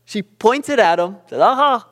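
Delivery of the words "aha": high, loud playful "gotcha"; joking threat. Retold or imitated. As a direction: imitate a mom springing a mock ambush. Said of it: "to be funny".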